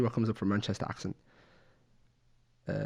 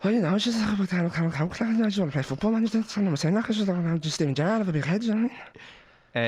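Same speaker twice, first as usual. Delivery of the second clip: higher-pitched Scouse footballer impression; Scouse accent; 7 semitones higher than usual. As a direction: run the words together in a sing-song Scouse accent.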